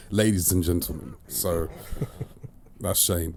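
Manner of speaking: normal talking voice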